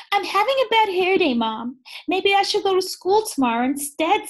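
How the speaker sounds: high, loud, quick pleading kitten; whiny Splat. Acted. Splat pleads with his mom to skip school, high and whiny. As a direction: beg to stay home. Splat's excuse read aloud.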